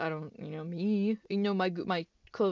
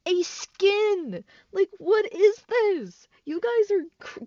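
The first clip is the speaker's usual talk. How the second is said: much higher and louder, voice cracking almost into a cry; outraged yell; 12 semitones higher, 10 dB louder, much slower than usual. Real, not acted. Vlogger yelling at the community for threatening someone over a game skin. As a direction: shout the absurdity at them, nearly breaking.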